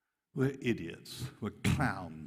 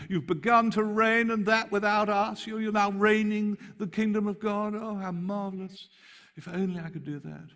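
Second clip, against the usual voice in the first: much higher mock delight; tongue in cheek; 12 semitones higher, 5 dB louder than usual. Paul mocking their reign, high gushing sarcasm. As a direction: gush over them, dripping sarcasm. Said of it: "Tongue in cheek".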